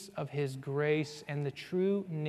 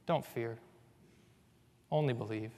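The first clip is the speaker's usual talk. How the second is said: calm; 4 semitones lower, 6 dB quieter, faster than usual. Acted. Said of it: "And he's so calm".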